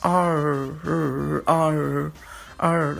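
drawn-out American R, tongue curled; stretched, isolated American "arr". Shown as a demonstration. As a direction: hold the R sound long with the tongue curled. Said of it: "لسانك لف حوالين بعضه كده شويه".

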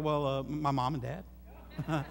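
low, slow, sheepish mumble; a low, slow, caught-out reply to God; sheepish answer. Retold or imitated. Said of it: "I'll be like".

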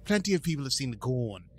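lightly put-on British accent; retelling La'an's line in a mock British voice. Host redoing La'an's line in a British accent. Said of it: "British accent".

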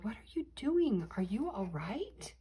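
usual speaking voice

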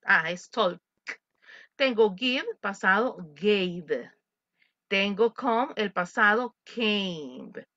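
ordinary talk, nothing marked

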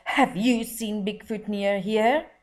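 lightly voiced suspicious man; suspicious question. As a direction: press the children with a hard stare. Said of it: "he asked".

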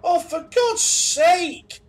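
loud, drawn-out exasperated yell; exasperated shout. Real, not acted. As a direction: shout it out in frustration. Frustrated outburst in horror game.

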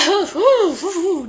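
high, loud hooting laugh. Real, not acted. Vlogger after ranting about a pop star, hooting laugh. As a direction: hoot with laughter in short bursts.

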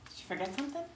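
low, very soft sneaking voice; hushed. Acted. Woman seeing lover out at night, hushed. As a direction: keep it down so nobody wakes. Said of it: "Why are we whispering and sneaking around?".

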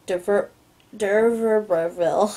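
"d'Urberville" slowly sounded out after a false start; stumbling pronunciation. Real, not acted. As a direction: trip on "de Ver-", then drag out "d'Urberville". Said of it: "I hate that, whatever that word is".

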